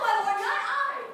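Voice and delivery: high, loud frantic protest; loud denial. Acted. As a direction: cry out your innocence. Frightened denial in a scene.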